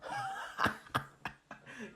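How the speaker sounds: very high squeaky villain giggle; shrill manic villain laugh. Acted. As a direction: cackle like an unhinged fanboy villain. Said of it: "and Shigar's like".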